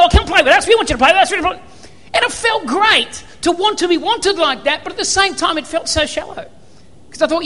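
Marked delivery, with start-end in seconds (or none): 0.0-1.3 louder, keen calls across the car park; eager calling out. Retold or imitated. Footy players to the new star, louder eager calls. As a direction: beg him to join, loudly. Seven people going.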